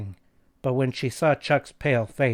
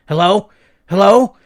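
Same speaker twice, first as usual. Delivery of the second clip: louder, rising anxious hellos; urgent calling out; 8 semitones higher, 13 dB louder than usual. A narrator's reading. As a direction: call out into the phone. Calling into dead phone line.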